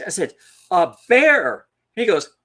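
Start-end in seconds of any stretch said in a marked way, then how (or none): 0.7-1.7 loud, higher retold cry; blurted warning. Boy telling his dad what he saw, blurted warning. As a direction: burst out with it at last.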